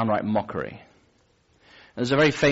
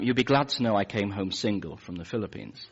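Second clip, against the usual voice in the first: usual speaking voice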